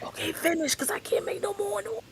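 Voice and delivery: hushed urgent whisper. Retold or imitated. On the phone late at night, hushed whisper. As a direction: whisper it, keep it down. Whispering so mom won't hear.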